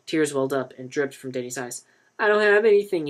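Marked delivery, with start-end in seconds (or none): 2.2-3.1 higher, louder, with a choke; a boy choking on his words; choked out. A narrator's reading. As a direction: force it out through tears. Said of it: "he choked out".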